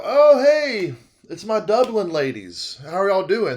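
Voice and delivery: high, loud, delighted hello; big showy welcome, starting high; gushing greeting back. Retold or imitated. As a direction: greet them with hammed-up enthusiasm. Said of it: "And I go".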